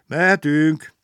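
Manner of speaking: loud, high departure call; shouted call. A narrator's reading. Conductor signals the train to leave, shouted call. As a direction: call it out to the front. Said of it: "kiáltott előre".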